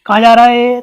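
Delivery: ए ए shouted like calling someone; a loud mock call to a boy walking off. Shown as a demonstration. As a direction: call across the street. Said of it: "ज़ोर से आवाज़ लगाएंगे".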